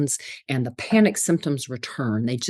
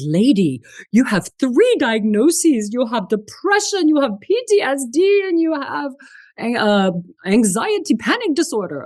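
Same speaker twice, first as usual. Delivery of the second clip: psychiatrist quote; 8 semitones higher, 4 dB louder than usual. Retold or imitated. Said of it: "He said".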